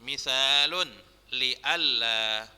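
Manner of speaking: slow, measured Arabic read in phrases; drawn-out syllables with long pauses between phrases; dictation reading. Shown as a demonstration. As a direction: read aloud slowly for students to mark vowels. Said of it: "Ustaz bacakan aja".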